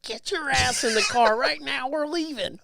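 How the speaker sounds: mock Southern drawl giving an urgent order; southern accent. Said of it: "her little southern accent".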